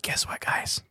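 soft secret whisper. Real, not acted. Secret confession.

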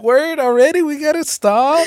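high, loud disbelief; shocked it's over already; surprised. Retold or imitated. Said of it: "I was just like".

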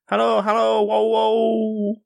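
high hunting halloo; hunters' cry. Acted. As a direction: sing out like huntsmen chasing hounds.

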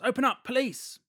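high, loud retold shout; mock police shout. Host voices police at a door, mock shout. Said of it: "and then someone shouts".

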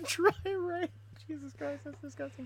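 high, laughing disgusted squeal. Real, not acted. Disgusted reaction after a crude joke.

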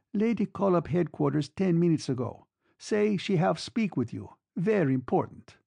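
an Italian orderly relaying a message; Italian accent. A narrator's reading. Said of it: "The accent was Italian".

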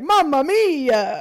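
slow, loud, high mock Italian accent; hammy Italian accent. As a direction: stretch it out like an Italian accent warm-up. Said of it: "practice Italian".

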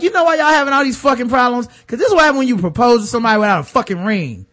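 high, loud, fast exasperated rant; mock rant. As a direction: scold them like a fed-up viewer. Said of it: "And I was like".

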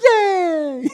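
high laughing yay; cartoon cheer. Last cereal piece cheering while drowning, high. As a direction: cheer gleefully like a tiny cartoon. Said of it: "the last one's like".

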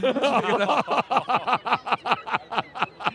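helpless run of laughter; long laugh. Real, not acted. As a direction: crack up at your own joke. Laughing at own crude joke.

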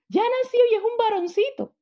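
alto y agudo, lleno de alegría; anuncio emocionado. Retold or imitated. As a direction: anuncia el nacimiento con júbilo. Otro anuncio de nacimiento. (anuncio jubiloso de nacimiento)

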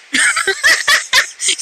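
real burst of high giggles; high-pitched laughing fit; high laughter. Real, not acted. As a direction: crack up at the cat. Laughing at cat's look.

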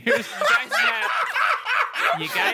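laughing high squeal. Real, not acted. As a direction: crack up mid-sentence. Laughing disbelief.

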